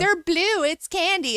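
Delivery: put-on high, sing-song toddler voice; a silly little kid sure it's candy; childlike mock voice. Mocking a toddler who thinks pills are candy, high childish voice.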